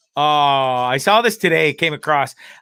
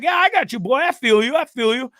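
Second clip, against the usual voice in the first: put-on much higher, cocky street-kid voice; imitating the kid; 7 semitones higher than usual. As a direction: mimic the dancer egging on his rival. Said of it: "He's like".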